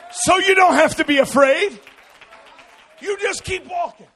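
loud, high, shouted sermon climax; full-force preaching. Acted. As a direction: preach it with everything you have.

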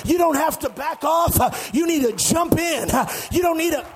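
driving, rhythmic sermon shout; full-force preaching. Real, not acted. Preacher telling the church shouting is human, full-force preaching. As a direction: pile up the phrases in a hard preaching cadence.

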